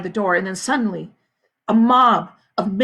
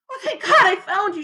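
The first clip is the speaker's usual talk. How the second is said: relieved; 11 semitones higher, 4 dB louder than usual. A narrator's reading. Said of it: "she sighed".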